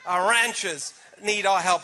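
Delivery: RANCHERS drawn out slowly with an American flat A; put-on American "ranchers", stretched and relished; American accent. Shown as a demonstration. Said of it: "say it in an American accent".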